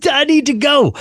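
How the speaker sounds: very high, urgent outburst; sudden urgency. Retold or imitated. Driver heading to the preacher's house, suddenly urgent. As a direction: burst out as if seized by the idea.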